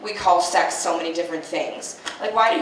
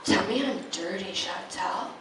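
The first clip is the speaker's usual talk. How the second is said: mock sultry murmur; 10 dB quieter than usual. Hookup in bed, asking softly and seductively. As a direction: purr it like a bedroom request. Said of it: "she was like".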